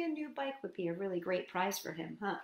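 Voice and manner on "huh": ordinary delivery, nothing marked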